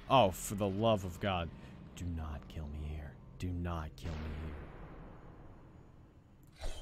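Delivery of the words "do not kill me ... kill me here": tense pleading whisper. Real, not acted. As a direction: whisper the plea urgently.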